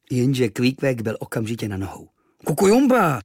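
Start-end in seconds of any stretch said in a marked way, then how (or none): 2.5-3.2 high, loud reproachful call; a reproachful islander's high call. Acted. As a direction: scold a friend for sneaking off. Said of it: "řekl trochu vyčítavě".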